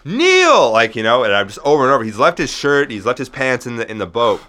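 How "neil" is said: high, loud, drawn-out yell; screaming a name. Retold or imitated. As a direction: call desperately after someone, stretching the name. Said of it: "I'm, like, screaming at him".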